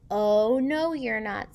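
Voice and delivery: loud, bossy no; bossy refusal. Acted. George shouting at the twins to stay out, bossy and loud. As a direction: slam the door on them with your voice.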